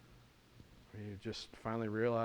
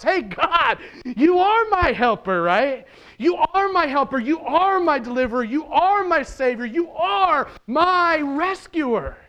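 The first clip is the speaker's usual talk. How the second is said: high, loud, triumphant cry to God; joyful shout; 15 dB louder than usual. Acted. Risen Jesus proclaiming praise to his Father, joyful shout. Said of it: "as he joyfully shouts".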